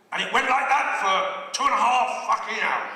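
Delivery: high, loud exasperation; exasperated loud complaint. Real, not acted. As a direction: raise the voice in comic outrage. Exasperated at endless shouted poetry.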